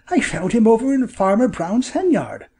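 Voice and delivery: high-pitched excited bird call; crying out. A narrator's reading. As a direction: cry out the good news, high. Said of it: "cried Bob".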